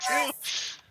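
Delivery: high, loud cheer; excited. Real, not acted. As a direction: yell it in delight. Cheering a friend's slip.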